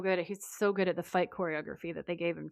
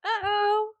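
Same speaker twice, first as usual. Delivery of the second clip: high, loud, drawn-out "uh-oh"; playful alarm; 14 semitones higher, 5 dB louder than usual. Pretend. Co-host echoing alarm at the story's turn, high playful dread. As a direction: sing out mock dread in a high voice.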